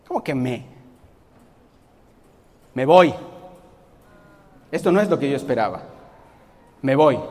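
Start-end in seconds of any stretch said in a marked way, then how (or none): 2.7-3.1 huffy exit. Pretend. Acted huff walking out. 6.8-7.2 huffy exit. Pretend. Acted huff walking out.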